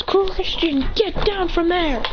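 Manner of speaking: high, slow acted reprimand; scolding order. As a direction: order a student down, drawing it out. Teacher figure orders student down.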